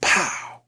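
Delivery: a sharp whispered pow; imitated gunshot. Retelling the episode's gunshot, a whispered mock pow. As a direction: breathe out a gunshot sound. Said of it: "you hear the, the sound of a gun firing".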